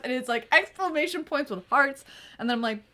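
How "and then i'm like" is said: normal talking voice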